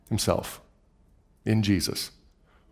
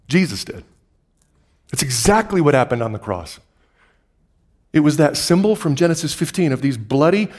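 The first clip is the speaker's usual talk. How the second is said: ordinary talk, nothing marked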